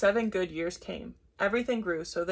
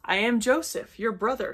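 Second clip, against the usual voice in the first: loud, higher revealing cry; 4 semitones higher, 6 dB louder than usual. A narrator's reading. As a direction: announce it as a storybook exclamation. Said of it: "he cried".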